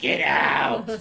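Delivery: drawn-out mock yell; mock angry ex. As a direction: imitate an ex throwing someone out. Ex throws him out in retelling.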